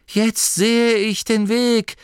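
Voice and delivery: high, loud, slow exultant cry; ausgerufen. A narrator's reading. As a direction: proclaim it like a revelation. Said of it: "rief er". (laut und verzückt ausgerufen)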